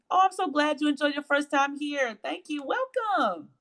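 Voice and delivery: high, sing-song gushing welcome; delighted welcome. Real, not acted. Host thanking a first-time viewer, high-pitched and delighted. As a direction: greet a newcomer in a high, delighted voice.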